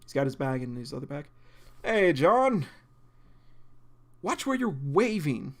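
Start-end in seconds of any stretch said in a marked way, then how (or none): 1.8-5.5 higher, louder cheerful hello; friendly greeting voice. Acted. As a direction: greet him brightly. Voicing William.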